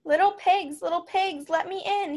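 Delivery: mild storybook wolf demand; wolf growl. A narrator's reading. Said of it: "he growled".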